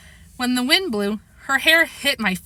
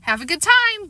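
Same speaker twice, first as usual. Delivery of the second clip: lightly called goodbye, pitched up; calling out; 10 semitones higher than usual. A narrator's reading. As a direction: call it after them in a higher voice. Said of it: "Beverly called".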